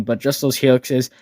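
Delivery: usual speaking voice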